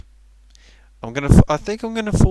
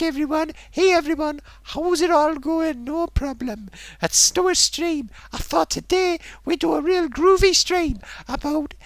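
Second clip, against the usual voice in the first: high, lilting mock-cheerful patter; dead jolly host; 11 semitones higher than usual. Imitating streamer introducing a groovy stream, dead jolly. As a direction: ham up a happy host introducing a show, pitched high. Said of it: "'cause I'm dead jolly and all that".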